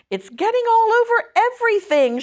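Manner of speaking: narrator's mild, high-pitched shout; shouting child. A narrator's reading. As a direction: call it out high like a tattling girl. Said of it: "shouted Fern".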